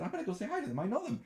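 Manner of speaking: high-pitched eager retelling; excited announcement. Retold or imitated. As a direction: blurt it out high, thrilled to know them. Retelling excitement at spotting celebrity friends.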